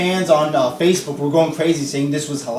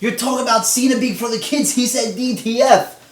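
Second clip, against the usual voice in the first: higher, sarcastic imitation of gushing fans; mocking fans; 5 semitones higher than usual. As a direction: parody the fans cheering Cena on, a bit higher. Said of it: "you're gonna have people on here saying".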